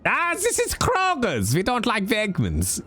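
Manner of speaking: mock German accent. Streamer testing the joke on Kroger, fake German accent. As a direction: play a snooty German at Kroger's. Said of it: "You see? It works".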